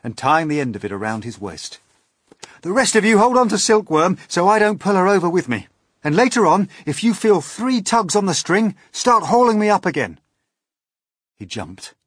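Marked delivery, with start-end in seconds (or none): 2.6-10.2 loud, high, pressing instructions; boy hero shouting instructions; urgent cry. A narrator's reading. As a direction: call out orders in an urgent raised voice. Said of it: "cried James".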